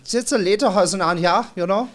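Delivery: loud, high mock-German accent; caricatured German, pushed up and belted; goofy over-the-top German accent; comic German character. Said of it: "do, like, the characters".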